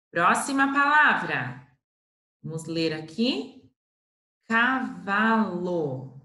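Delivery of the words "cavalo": "Cavalo" drawn out very slowly; slow reading. Shown as a demonstration. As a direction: sound out each syllable. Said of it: "teacher reads word slowly".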